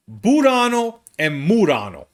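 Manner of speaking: "Burano and Murano" said the Italian way, r lightly tapped; Italian pronunciation. Shown as a demonstration. Explaining the two Venice islands, names said with an Italian tapped r. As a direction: roll the names like an Italian would.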